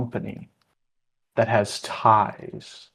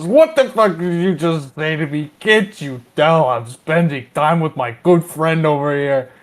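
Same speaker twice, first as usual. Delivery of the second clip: put-on louder, higher drunk comeback; angry retort; 6 semitones higher, 5 dB louder than usual. Larry turning on the heckler, picking a fight, angry retort. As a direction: snap back, offended and itching for a fight. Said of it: "Larry just turns around and says".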